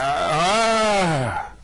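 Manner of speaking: a short high cry as he drops; falling yelp. Acted. Zaphod crying out as he falls down the hole. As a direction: yelp mid-fall.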